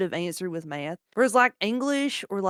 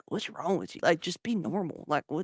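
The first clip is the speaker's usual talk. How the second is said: muttered disapproval; 3 semitones lower, 7 dB quieter than usual. Retold or imitated. Normal people quoted.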